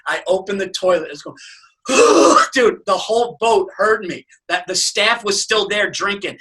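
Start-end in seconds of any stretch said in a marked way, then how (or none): 1.8-2.5 loud, high vomiting heave; retching. Retold or imitated. Seasick man over a boat toilet, loud retch. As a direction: heave noisily as if puking.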